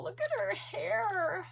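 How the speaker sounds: high, teary voice, moved; tearful. Real, not acted. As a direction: talk through happy tears. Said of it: "Sorry, guys, for bawling".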